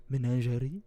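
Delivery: French accent. Shown as a demonstration. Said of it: "We're gonna say it in a French accent".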